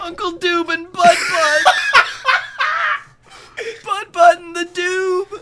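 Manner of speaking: high, loud laughing cheer; squealing show intro; excited cheer. Pretend. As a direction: hype the title like a giddy fan. Cheering the show title in character.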